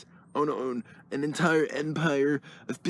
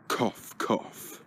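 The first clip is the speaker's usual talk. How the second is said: soft breathy fake cough; voiceless mock cough; acted cough; 4 semitones lower, 6 dB quieter than usual. Shown as a demonstration. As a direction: mime a little sick cough. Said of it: "I don't need to act like that while I say cough".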